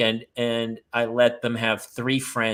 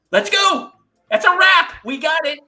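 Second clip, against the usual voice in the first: high, loud frazzled hurry-up; frantic call; 7 dB louder than usual. Retold or imitated. Dad to kids at the door, high loud frantic call. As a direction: call the kids out like you're losing it.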